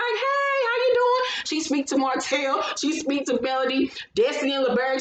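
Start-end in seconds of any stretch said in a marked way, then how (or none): 0.0-1.4 high-pitched mock-friendly hello; exaggerated cheery hello, imitating; put-on greeting. Voices Kimmy's greeting in retelling.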